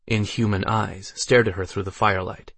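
usual speaking voice

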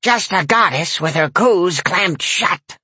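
slow high sneering granny; a crude crone mocking; sneering old lady. A narrator's reading. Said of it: "the old lady sneered".

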